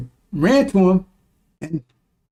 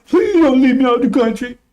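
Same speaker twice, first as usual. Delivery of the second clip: higher, pleading coward voice; mock begging demon; 6 semitones higher than usual. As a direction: sneer at the tough guy who begs. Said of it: "Big old tough, hard, core, firm".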